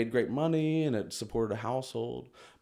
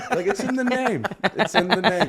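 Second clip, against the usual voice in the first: high, loud laughing; 10 semitones higher, 11 dB louder than usual. Real, not acted. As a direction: laugh through the words. Laughing at own joke.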